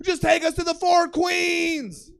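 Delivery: high, loud, stretched-out yell. Retold or imitated. Friend to Uber driver mid-argument, yelling. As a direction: yell the destination, dragging the last word out. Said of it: "Matt's yelling at him".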